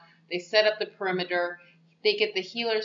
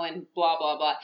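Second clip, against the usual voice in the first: ordinary talk, nothing marked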